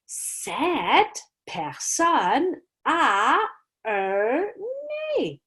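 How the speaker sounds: very slow, stretched-out clue. Shown as a demonstration. Teacher slowly spelling out a clue for the class. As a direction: draw out every word.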